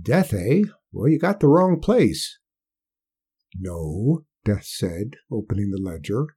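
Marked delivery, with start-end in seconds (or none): 0.0-2.4 put-on higher folksy old man; a stubborn backwoods old-timer; old country man. Old Hark voiced by narrator. 3.5-4.2 low, slow voice of Death; a quiet grim visitor; low looming threat. Acted.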